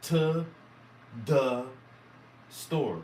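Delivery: To the store, To as loud ta; southern ta, stressed. Shown as a demonstration. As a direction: punch To out as ta. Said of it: "T A D A".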